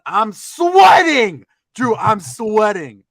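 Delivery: loud, high, drawn-out exclamation; worked-up exclaiming. Retold or imitated. Storyteller tells his friend how frantic it got, exclaiming. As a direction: stretch it out in loud exasperation. Excitedly relives frantic moment at bins.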